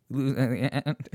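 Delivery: cracking voice through a laugh; hoarse voice breaking while laughing; voice giving out. Real, not acted. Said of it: "I'm losing my voice".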